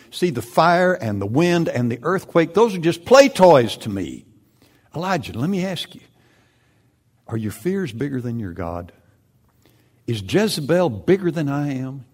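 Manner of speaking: God speaking. Acted. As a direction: speak as God, booming and proud. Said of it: "God speaking (paraphrase)".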